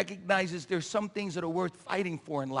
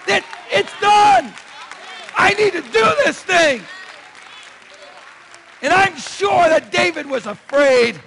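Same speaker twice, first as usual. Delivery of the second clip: loud, ringing sermon delivery; fiery preacher stirring the congregation; preaching at full force; 11 semitones higher, 12 dB louder than usual. Acted. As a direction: preach it out to the whole church.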